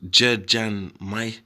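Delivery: Jed Jan Mai sounded out slowly, syllable by syllable; Jed Jan Mai drawn out in careful Thai sounds; Thai word read out. Shown as a demonstration. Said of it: "Let's look at another word".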